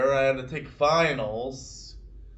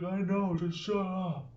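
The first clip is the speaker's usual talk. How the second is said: imitated exasperation, higher and softer toward the end; whiny mock brush-off, pitched up; exasperated; 4 semitones higher, 4 dB quieter than usual. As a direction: wave off the nagging in a retold voice. Rival brushes him off in retold quarrel.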